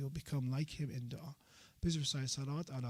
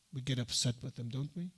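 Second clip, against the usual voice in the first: ordinary talk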